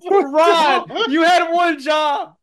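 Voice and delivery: high, loud comic outrage; loud scolding in disbelief; exasperated shout. Real, not acted. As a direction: yell it slowly at a friend who blew it. Berating player for bad roll.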